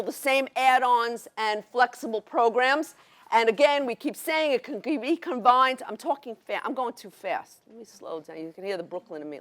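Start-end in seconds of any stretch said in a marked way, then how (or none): 5.9-9.4 low, very soft aside to self; real under-the-breath self-correction; self-checking aside. Real, not acted. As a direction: drop right down and check yourself quietly.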